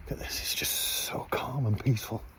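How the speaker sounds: hushed whisper to the camera. Real, not acted. Vlogger showing the silent campsite at night, whispering. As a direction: breathe it out so as not to disturb the night.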